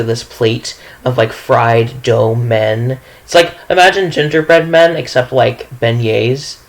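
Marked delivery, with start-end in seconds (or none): none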